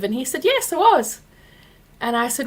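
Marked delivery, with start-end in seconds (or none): 0.4-1.2 high, loud mocking yes; mock smug admission. Boyfriend at table admitting he was vindictive, mocked high and loud. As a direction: imitate him owning it brazenly.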